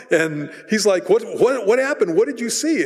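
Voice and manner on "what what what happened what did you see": alarmed. Retold or imitated. Saul alarmed questioning the witch.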